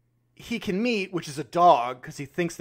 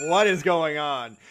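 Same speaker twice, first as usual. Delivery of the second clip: confused; slower than usual. Retold or imitated. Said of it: "I think she was confused".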